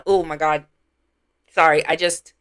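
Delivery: usual speaking voice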